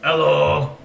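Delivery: calling out loudly; loud greeting. Acted. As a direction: boom the hello. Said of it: "How loudly are you saying this?".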